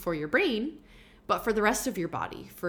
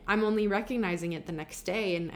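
ordinary delivery

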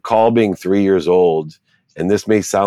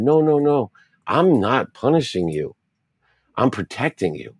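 lightly voiced mom, higher-pitched and reassuring; comforting mom; 7 semitones higher than usual. Mom hugging her punished-feeling son, reassuring. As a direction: reassure a crying child in a raised pitch. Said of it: "she hugged me immediately".

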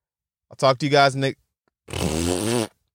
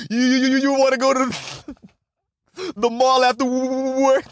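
mock stutter, higher pitch; imitating a stutter; 8 semitones higher than usual. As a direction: stammer out the question mockingly. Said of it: "We'd be like".

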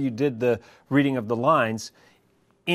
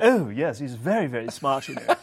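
playful mock English accent; put-on English accent. Guest mocking assumptions about English speakers, put-on English accent. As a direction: parody a very clever English speaker. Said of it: "people who have English accents".

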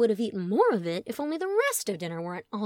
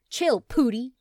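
dismissive; 4 semitones higher, 7 dB louder, slower than usual. Acted. Chet dismissing brother.

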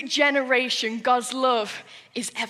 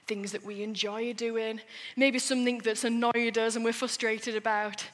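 usual speaking voice

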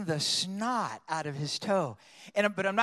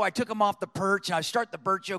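ordinary talk, nothing marked

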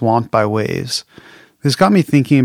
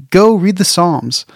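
ordinary talk, nothing marked

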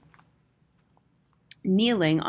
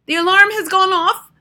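very high, loud shriek; piercing witch cry; 13 semitones higher, 12 dB louder than usual. A narrator's reading. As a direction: shriek it in wild triumph. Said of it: "shrieked the Grand High Witch".

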